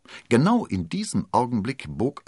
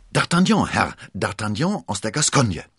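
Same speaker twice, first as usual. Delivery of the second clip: quick reply; 4 semitones higher, 6 dB louder than usual. Acted. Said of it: "wie aus der Pistole geschossen".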